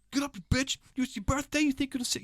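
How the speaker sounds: hammed-up tough-guy coach; David Goggins shouting. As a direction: bark it like a hardcore motivator. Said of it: "So he's going".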